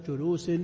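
جلوس said julusin, ending in -in; genitive tanwin. Shown as a demonstration. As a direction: sound the final -in on جلوس. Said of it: "لأنها تقبل التنوين".

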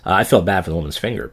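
ordinary delivery

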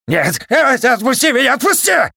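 very high, loud terrified screaming; panicked frantic pleading. Acted. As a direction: shriek and beg to be let go. Pomidor lifted by robot.

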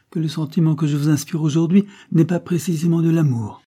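high-pitched, teasing mockery; mocking banter. A narrator's reading. Creole mocking the duke's lack of love, bantering. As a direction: needle him with airy persiflage. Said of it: "reprit-elle d'un ton de persiflage".

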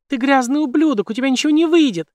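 very high, loud enraged scream; furious scream. A narrator's reading. Jane screaming in rage at the villain, furious high scream. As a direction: scream it with all her fury. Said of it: "в ярости закричала".

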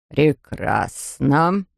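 low, very slow approval; slow, satisfied dentist; approving dentist. A narrator's reading. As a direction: draw the word out in a deep voice. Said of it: "одобрил мистер Лич".

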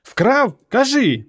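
very high, sharp demand; frantic high-pitched interrogation; mother yelling. A narrator's reading. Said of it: "зарепетувала мати".

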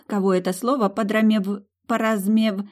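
подрамев- garbled and broken; tongue-tied. A narrator's reading. Susie garbling a hard word, stumbling. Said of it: "опять помогла ей Кендра".

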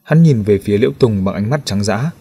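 ordinary delivery, nothing marked

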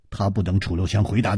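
plain reading voice

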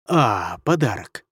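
oh, nearly forgot; sudden recollection. A narrator's reading. Demon lord suddenly remembering his gift to the emperor. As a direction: remember it mid-breath. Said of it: "спохватился владыка демонов".

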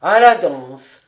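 tipsy slow shout of "à la danse"; rowdy echoed shout. A narrator's reading. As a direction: bellow it back as a group. Tipsy friends echoing the call.

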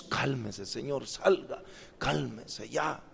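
low, hushed hospital command; acted soft, firm shushing; retold calming order. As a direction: quietly usher out a noisy visitor. Doctor retells hushing noisy prayer groups.

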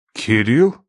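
ordinary delivery, nothing marked

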